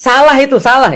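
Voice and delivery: laughing pun. Real, not acted. Joking pun on Salah.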